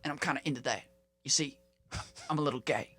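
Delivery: lightly voiced ex-president, hushed; George W. Bush. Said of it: "I'm George W".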